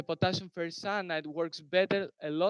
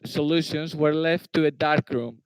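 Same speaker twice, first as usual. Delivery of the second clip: raised, projected voice; speaking louder; 9 dB louder than usual. Real, not acted. Presenter repeats answer for questioner who couldn't hear, louder. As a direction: project so the listener can hear. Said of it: "can you hear me now?".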